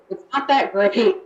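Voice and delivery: normal talking voice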